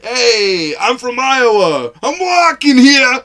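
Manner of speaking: loud, slow, high New England tough-guy voice; mock New England accent. Mocking Ryan's New England accent to a friend, loud and slow. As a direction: ham up a thick New England accent, yelling slowly. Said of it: "had a New England accent".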